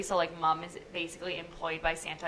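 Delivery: ordinary talk, nothing marked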